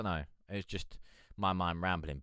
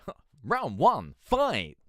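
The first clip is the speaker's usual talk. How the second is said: higher, louder sarcastic announcer call; sarcastic upbeat announcer; 6 semitones higher, 7 dB louder, slower than usual. Shown as a demonstration. Streamer demoing for the artist, sarcastic announcer. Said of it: "put on a really, really sarcastic, upbeat voice".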